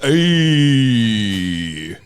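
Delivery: long, loud drawn-out catchphrase; Fonzie. Acted. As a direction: stretch it out like a sitcom cool guy. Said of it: "Fonzie's favorite podcast".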